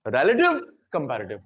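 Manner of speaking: pair relative up and comparative down; contrastive drill. Shown as a demonstration. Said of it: "pronunciation से meaning बदल जाता है". (Relative ऊँचे उठाकर, कंपैरेटिव नीचे गिराकर)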